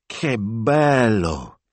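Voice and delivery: high, drawn-out admiration; gushing Italian exclamation; rapturous signora. A narrator's reading. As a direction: swoon over him slowly. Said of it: "cried Signora Barbaro".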